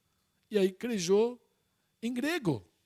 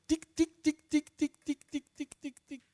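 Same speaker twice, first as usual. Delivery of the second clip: high, quick tic tic tapping sounds like Morse code; imitated tapping; 8 semitones higher than usual. Preacher imitates prisoners tapping code through walls, high tic sounds. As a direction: tap it out high like a prisoner knocking on the wall.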